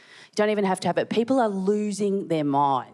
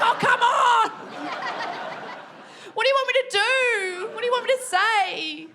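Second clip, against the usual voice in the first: exasperated; 13 semitones higher than usual. Retold or imitated. Asked about dinner, high exasperated whine at husband. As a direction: wail at him in high disbelief. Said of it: "I was like".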